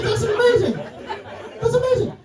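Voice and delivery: ordinary talk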